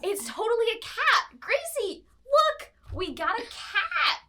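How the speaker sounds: put-on high, bright excited valley girl squeal. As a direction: gush about the cat like a thrilled teen. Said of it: "you see a girl says".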